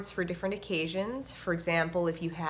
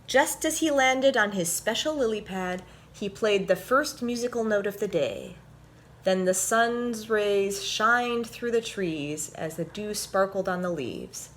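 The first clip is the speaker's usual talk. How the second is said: put-on bright, louder storybook narration for kids; animated picture-book read-aloud; reading to children; 3 semitones higher, 6 dB louder than usual. A narrator's reading. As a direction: read it like story time for little ones.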